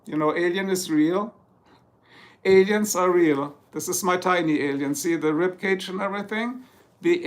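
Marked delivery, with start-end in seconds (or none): none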